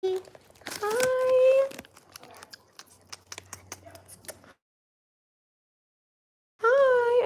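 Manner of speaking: high cooing greeting to a pet; pet talk. Real, not acted. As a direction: coo hello to the dog. Greeting her pets.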